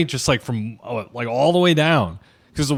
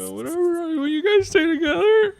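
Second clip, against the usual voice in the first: high-pitched pretend crying voice; mock tearful. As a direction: whine it through fake sobs. Mock pitiful quote, then "It is sad.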